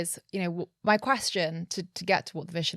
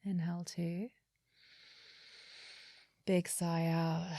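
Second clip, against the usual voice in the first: guided meditation; 7 dB quieter than usual. Acted. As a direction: calmly guide each breath. Leads a breathing exercise.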